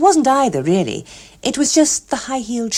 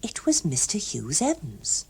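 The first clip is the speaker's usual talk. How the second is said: plain reading voice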